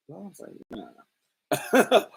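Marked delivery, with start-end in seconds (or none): none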